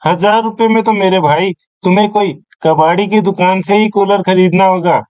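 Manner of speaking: usual speaking voice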